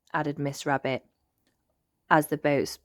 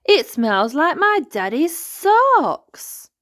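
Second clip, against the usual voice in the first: high, loud, slow disgust; boy pony grossed out; disgusted Pedro; 11 semitones higher, 9 dB louder, slower than usual. Acted.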